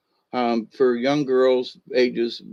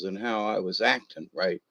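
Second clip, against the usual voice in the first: plain reading voice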